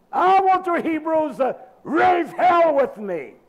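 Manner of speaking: loud, high, drawn-out fiery cry; fiery preacher shout; preaching shout. Real, not acted. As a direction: shout it out at them, slow and big. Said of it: "That's why I'm talking like this".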